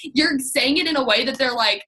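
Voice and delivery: usual speaking voice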